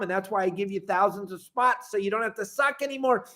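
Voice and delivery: ordinary talk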